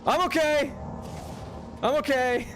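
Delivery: high-pitched after a bad fall; shaken reassurance. Real, not acted. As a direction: squeak out that you survived.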